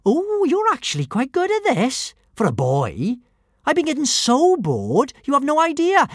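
put-on high, chatty girl voice; chatty little girl. A narrator's reading. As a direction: chat casually. Girl chatting mid-fight.

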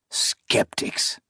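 breathy scornful snort of a word. A narrator's reading. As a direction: spit it out in contempt. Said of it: "he snorted".

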